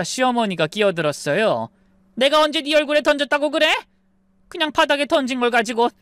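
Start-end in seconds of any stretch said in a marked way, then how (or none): 2.1-5.9 mother-in-law denying. Acted. Mother-in-law denies throwing.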